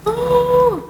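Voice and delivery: high, drawn-out ghostly "Oh"; a spooky, high held "Oh" like an unseen ghost; eerie ghostly moan. Retold or imitated. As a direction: imitate the strange moaning sound heard at night. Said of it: "Ada suara".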